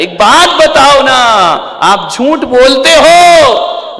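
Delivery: high, loud, drawn-out preaching question; shouted challenge. Real, not acted. Preacher asking listeners whether they lie, shouted challenge. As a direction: challenge the whole hall at full voice.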